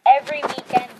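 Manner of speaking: loud, excited announcing. Real, not acted. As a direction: call it out eagerly. Loud, excited clan talk.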